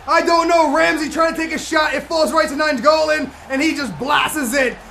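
very loud, high shout, easing as it explains; elated goal celebration. Real, not acted. Gamer celebrating a scored goal, elated shouting. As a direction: yell the joy out, then recount it loudly.

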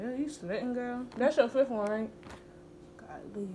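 garbled through marshmallows; mouth full. Real, not acted. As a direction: talk with stuffed cheeks.

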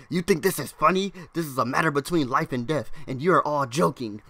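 loud outburst, words hit hard; yelling. Retold or imitated. Kid yells at the class from the front, yelling. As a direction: yell it out at the whole class. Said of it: "he yells".

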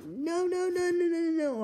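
high frantic pleading; panicked no's. Acted. Ghost eaten again.

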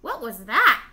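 put-on loud baby bird outcry; cried out. A narrator's reading. Startled baby bird asks siblings about the stranger, cried out loudly. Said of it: "cried Flap".

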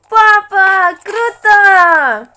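high, loud thrilled shout; excited yell. Real, not acted. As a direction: yell your delight across the room. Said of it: "Не, не кричи".

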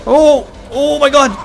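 shrieks climbing extremely high; panicked screaming. Real, not acted. Player about to be hit, screaming in panic.

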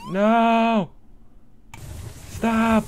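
high, loud panicked yell; panicked shout. Real, not acted. Crew in danger, yelling.

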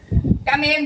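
high little voice with laughter; high-pitched, laughing call; small voice demo. Shown as a demonstration. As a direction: call the name in a tiny high voice. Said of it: "เสียงเล็กนี่คือเสียงแบบ".

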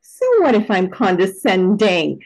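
ordinary delivery

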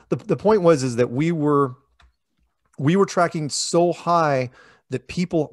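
usual speaking voice

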